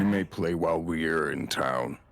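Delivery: low, measured guard voice; stern warning. Acted. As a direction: lean in and lay down the rule. Hoster warns the drunk quietly.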